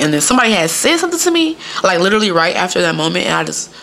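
ordinary talk

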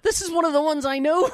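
loud, high gasping delight at a known song; excited. Retold or imitated. Retelling the concert to friends, high excited shout. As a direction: squeal it like you finally recognise one.

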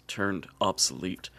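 ordinary talk, nothing marked

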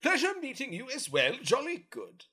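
a proper lady soldier signing off; female Gith voice. Acted. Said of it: "This is my female Gith voice".

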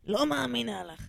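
a lightly put-on, slow disbelieving voice; Gila's voice. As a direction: dismiss it as Gila would. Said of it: "שהיא מדברת ככה". (גילה בקול מפקפק ואיטי)

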